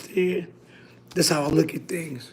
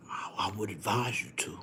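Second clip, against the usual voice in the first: whispered, much lower, much softer warning; mock mafia whisper; 8 semitones lower, 12 dB quieter than usual. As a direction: warn him in a hushed mob whisper. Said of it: "they talk low".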